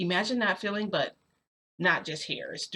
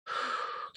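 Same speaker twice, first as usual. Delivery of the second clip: relieved sigh; 6 dB quieter than usual. Retold or imitated. Relieved sigh after close call.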